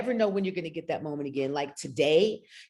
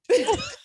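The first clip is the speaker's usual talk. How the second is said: high laugh; 6 dB louder than usual. Real, not acted. Moved to tears on Zoom.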